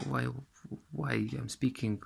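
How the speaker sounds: ordinary talk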